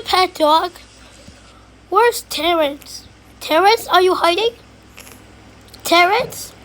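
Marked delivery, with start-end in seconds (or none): none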